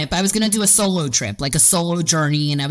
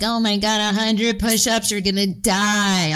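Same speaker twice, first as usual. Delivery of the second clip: slightly higher, drawn-out mock dread; dramatic inner voice; 3 semitones higher than usual. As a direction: stretch it out like a dramatic complainer. Voices the whining inner committee member.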